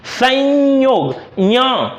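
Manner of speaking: संयोग said very slowly with the य sound stressed; teacher drawing out संयोग; slow demo pronunciation. Shown as a demonstration. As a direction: stretch संयोग to show the य. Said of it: "उच्चारण इसका य आता है".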